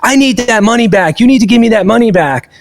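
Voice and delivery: high, heated complaint from the angry customer; retold angry customer. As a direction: play the customer ranting and demanding his money back. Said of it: "he's screaming".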